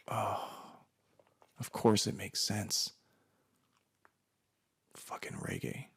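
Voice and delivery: soft, slow dawning, sinking to a whisper; muttered realization. Retold or imitated. Working out why the audience smiles, quiet disgusted muttering.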